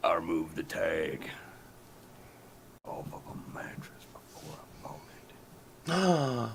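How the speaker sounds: slow, hushed whispery voice; lifer's confession. Acted. Prisoner serving life confesses his crime, slow haunted whisper. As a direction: draw out a grave whispered confession. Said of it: "there's a guy in there serving live sentence".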